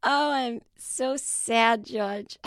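slow, high mock sad mom; imitating mom. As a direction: drag it out with mock sorrow. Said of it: "she's like".

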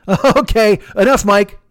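sharp loud "enough" to shut a buddy up; loud fed-up cutoff. Retold or imitated. Friend shouting at Mike to stop, loud and fed up. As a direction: cut him off loudly, fed up.

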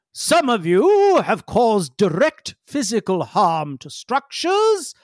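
slow, grave official reading out charges; formal Feledrin. Acted. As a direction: list their crimes slowly like a stern tribunal. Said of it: "Feledrin interrupts and says".

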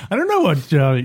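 ordinary talk, nothing marked

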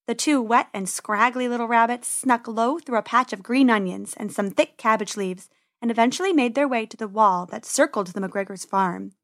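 ordinary delivery

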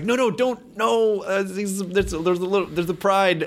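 high-pitched flurry of objections; urgent protest. Acted. Viewer objecting to the character's mistakes, urgent protest.

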